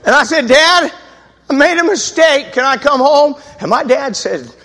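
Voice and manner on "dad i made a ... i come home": begging dad. Retold or imitated. Pleading phone call to dad.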